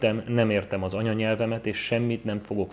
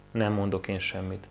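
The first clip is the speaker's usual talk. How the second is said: usual speaking voice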